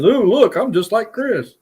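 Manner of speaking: high-pitched teasing mimicry, slowing at the end; imitated kid. Retelling a kid dressed up as Chris, playful high mimicry. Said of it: "and goes".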